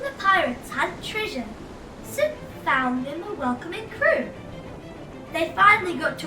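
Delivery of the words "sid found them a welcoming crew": plain reading voice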